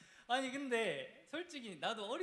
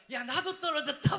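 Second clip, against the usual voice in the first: laughing through it, high and loud; 9 semitones higher, 11 dB louder than usual. Retold or imitated. Friend confessing he also failed, laughing. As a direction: admit it while cracking up.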